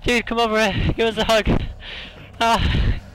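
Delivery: high, loud relieved call; relieved shout. Real, not acted. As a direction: cry out glad to see your friend. Relieved reunion after panic.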